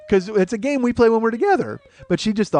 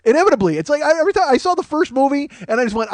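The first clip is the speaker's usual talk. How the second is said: ordinary delivery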